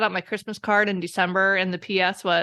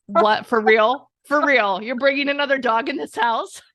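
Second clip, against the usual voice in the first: loud, disbelieving mock outrage; indignant cat; 4 semitones higher, 5 dB louder than usual. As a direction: protest like an affronted cat.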